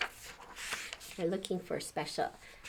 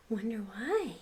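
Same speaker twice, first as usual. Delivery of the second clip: usual speaking voice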